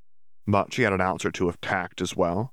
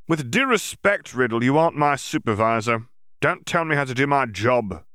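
louder, higher indignant official; 6 semitones higher, 4 dB louder than usual. A narrator's reading. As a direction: bristle at being told off. Yaxley rebuffs Riddle.

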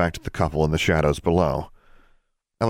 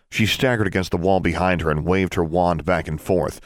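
ordinary delivery

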